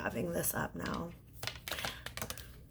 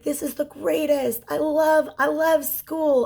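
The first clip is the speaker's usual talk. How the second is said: put-on high, eager little-kid voice; mock child gushing about school; small child; 8 semitones higher than usual. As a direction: play a tiny kid who loves school. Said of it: "being very, very small and being like".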